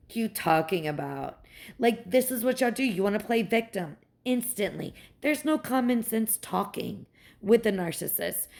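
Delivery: ordinary talk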